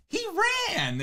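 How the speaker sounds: mock high, excited shout; high-pitched yell. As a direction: raise the voice way up. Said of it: "raises his voice really high".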